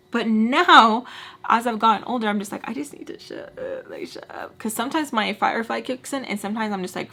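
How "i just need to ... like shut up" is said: hushed, voiceless aside; whispered self-rebuke. Retold or imitated. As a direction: whisper it to yourself, barely audible. Muttering self-reproach in retelling.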